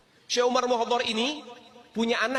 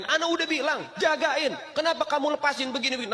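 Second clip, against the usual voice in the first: scolding wife; 7 semitones higher than usual. Acted. Husband scolds his wife for letting the child in, pitched up in reproach. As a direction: blame her for letting the child wander. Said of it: "dipanggil istrinya dimarahin".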